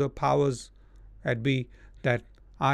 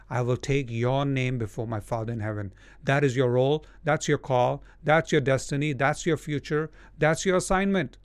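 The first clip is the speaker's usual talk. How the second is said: ordinary delivery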